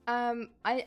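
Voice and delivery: ordinary delivery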